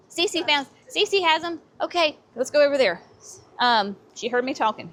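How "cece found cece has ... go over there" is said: sudden excited high voice. Real, not acted. Spots Cece has the plants.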